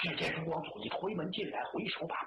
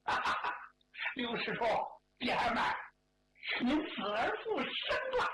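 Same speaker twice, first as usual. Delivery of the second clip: gleeful taunt; 5 semitones higher than usual. Acted. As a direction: crow at the man you just caught, stretching the last phrase. Gleeful mocking greeting after catching him. (得意地高声调侃)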